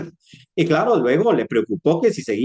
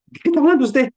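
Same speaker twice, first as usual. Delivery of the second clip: high, indignant doctor asking what he means; indignant surprise; 13 semitones higher, much faster than usual. Retold or imitated. Doctor to patient offering a bribe, indignant and high. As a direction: sound shocked at the bribe.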